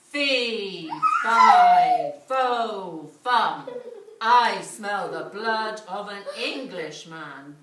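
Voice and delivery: loud, slow giant chant; booming giant. A narrator's reading. Giant sniffing out Jack, loud booming chant. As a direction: boom it like a huge ogre.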